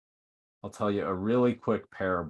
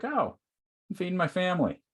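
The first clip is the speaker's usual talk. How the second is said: calm worker; 10 semitones higher than usual. Acted. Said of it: "seems calm".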